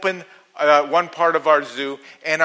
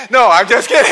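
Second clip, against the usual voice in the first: laughing tease; 4 semitones higher, 8 dB louder, faster than usual. Real, not acted. Teasing congregation.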